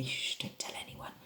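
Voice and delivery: playful hushed whisper; secretive whisper. Pretend. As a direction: shush them and whisper conspiratorially. Playful secret shared with pupils.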